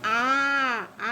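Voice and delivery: आ held as a long, steady aa; long vowel. Shown as a demonstration. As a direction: draw out the आ. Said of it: "इसको हम कैसे शब्द बनाते हैं".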